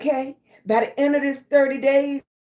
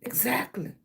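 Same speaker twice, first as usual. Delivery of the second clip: whispered; 8 dB quieter than usual. Real, not acted. Agreeing with a viewer's comment.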